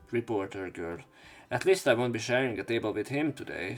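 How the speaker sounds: ordinary delivery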